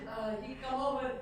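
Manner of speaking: usual speaking voice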